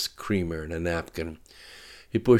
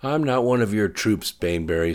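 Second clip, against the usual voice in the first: sharp retort; 4 semitones higher, 6 dB louder than usual. Acted. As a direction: snap back, stung and louder. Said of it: "sparked Dannetti".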